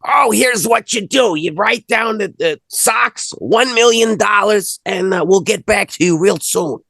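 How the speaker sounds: high, squawking comedian imitation; Gilbert Gottfried. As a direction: squawk it far up above your own voice. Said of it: "Gilbert Gottfried. And he's like".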